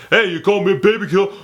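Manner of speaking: high, slurred Stallone-style protest; garbled, worked-up action-hero impression; Rambo. Vet answering the cops, slurred mock outrage. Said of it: "He's like".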